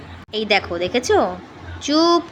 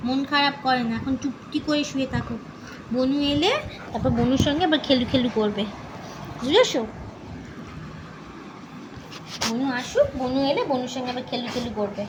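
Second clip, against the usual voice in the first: soothing pet talk; 2 semitones higher, 3 dB quieter than usual. Real, not acted. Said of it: "একটু খুঁচিয়ে খুঁচিয়ে একটুখানি ওকে charm করতে হয়".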